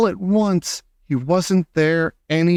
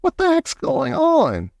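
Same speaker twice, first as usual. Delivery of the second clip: put-on much higher, slow boy's voice; puzzled boy asking; high, slow mock boy; Bruno asking; 12 semitones higher than usual. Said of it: "Bruno asked".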